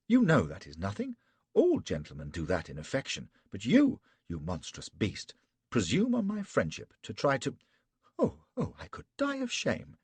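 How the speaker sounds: lightly voiced lady. A narrator's reading. Betty scolds Flashman.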